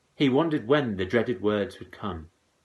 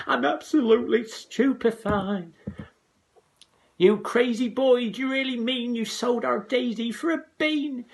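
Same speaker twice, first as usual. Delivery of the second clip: high, loud shrieking mother; mother shrieking in disbelief; 4 dB louder than usual. A narrator's reading. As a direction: screech it at the boy. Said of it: "leaped high up in the air and cried".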